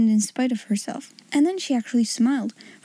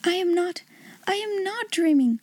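put-on a little girl convincing herself; tearful girl; 7 semitones higher than usual. Sarah's voice, amazed.

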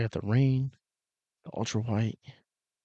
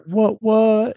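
high, loud "What, what?"; hype shout; 12 semitones higher, 8 dB louder than usual. Real, not acted. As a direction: yell it like a hype man. Playful hype call.

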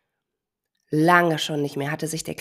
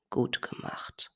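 slow, soft whispered praise; 7 dB quieter, slower than usual. Acted. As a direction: whisper it proudly. Grandma praises quietly.

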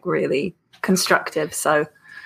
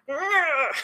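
mock groan of annoyance; 11 semitones higher, 3 dB quieter than usual. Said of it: "And I'm like".

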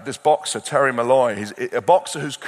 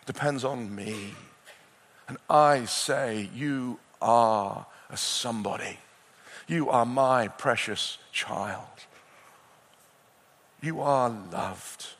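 a slow, lower, gently voiced God; God speaking; 4 semitones lower, 5 dB quieter than usual. Preacher voicing God's words to a precious child, slow and tender. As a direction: lightly voice God, slowing on each assurance. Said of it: "And the Lord our God says".

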